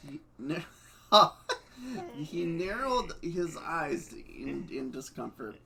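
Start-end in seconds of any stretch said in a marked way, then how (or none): 1.1-2.1 short loud laugh; burst of laughter. Real, not acted. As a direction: break into a laugh. Reader laughs mid-sentence.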